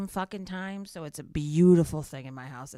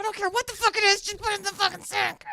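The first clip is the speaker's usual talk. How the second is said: much higher, fast ranting; exasperated rant; 14 semitones higher, 3 dB louder, faster than usual. Retold or imitated. Mom ranting at her daughter about the dish, high and fast. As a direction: snap it at her, fed up.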